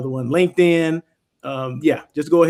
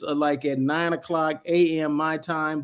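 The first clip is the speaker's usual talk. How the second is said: ordinary talk, nothing marked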